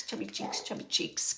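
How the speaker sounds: put-on soft whispered baby talk; grandma cooing softly at a chubby baby. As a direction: whisper it while pinching cheeks. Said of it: "she keeps going like this with your cheeks".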